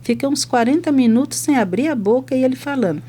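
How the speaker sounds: ordinary talk, nothing marked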